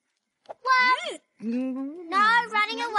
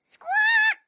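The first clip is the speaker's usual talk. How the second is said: high, loud parrot screech; shrill bird call; parrot squawk; 13 semitones higher, 5 dB louder than usual. Acted. Playing a parrot.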